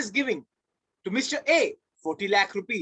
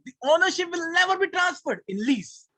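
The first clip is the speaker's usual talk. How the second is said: "never" hit high and hard; stress on never; 7 semitones higher than usual. Shown as a demonstration. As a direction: lean on "never". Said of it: "teacher stressing never".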